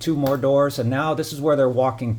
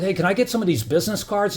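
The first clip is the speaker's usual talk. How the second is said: higher, mocking flattering voice; mock sweet-talking stranger; 7 semitones higher, faster than usual. Retelling the intruders' flattery to viewers, mocking higher voice. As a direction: imitate a smooth-talking intruder buttering him up. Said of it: "this guy decides".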